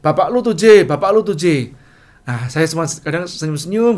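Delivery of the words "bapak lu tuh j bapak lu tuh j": godaan keras dan lebih tinggi; ledekan teman. Retold or imitated. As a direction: nyindir sambil tertawa-tawa. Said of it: "mengatakan begini". (ledekan teman di kantin, keras)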